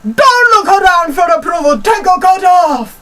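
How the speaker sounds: high, loud, stretched-out mock bellow; imitated scolding. Imitating lady catching pupil smiling at others, hammed-up scolding shout. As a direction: yell it furiously as the lady, hammed up. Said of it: "and she goes".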